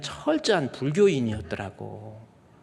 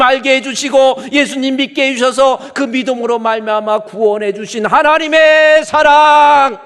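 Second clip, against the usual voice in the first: full-force preaching; 11 semitones higher, 15 dB louder than usual. Acted. Preacher declaring God's love to the congregation, full force. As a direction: proclaim God's choosing love at the top of your voice.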